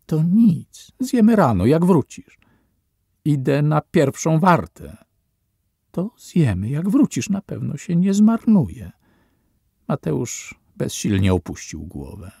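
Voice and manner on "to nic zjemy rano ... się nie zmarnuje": higher, pleading mother's voice; coaxing mother. Acted. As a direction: coax the boy like an anxious mother. Matka namawia syna do jedzenia. (matka prosząca syna, wyżej, błagalnie)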